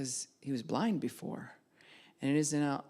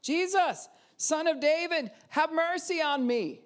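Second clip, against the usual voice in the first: crying out much higher and louder for mercy; shouting out; 12 semitones higher, 5 dB louder than usual. Acted. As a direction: blind beggar calls out to Jesus over the crowd, pleading shout. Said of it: "he began to shout".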